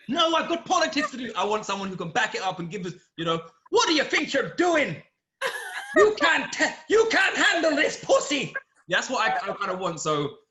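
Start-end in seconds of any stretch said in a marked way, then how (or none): 0.1-1.3 high, loud mock refusal; protesting politician. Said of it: "falling forward being like". 3.7-8.5 loud, high put-on Irish woman; mock Irish accent. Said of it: "best Irish accent ever".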